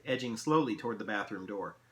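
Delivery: ordinary delivery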